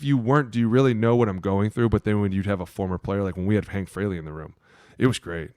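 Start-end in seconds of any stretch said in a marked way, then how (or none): none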